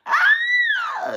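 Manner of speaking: sudden very high loud scream; high shocked shriek. Real, not acted. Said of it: "you just got me screaming".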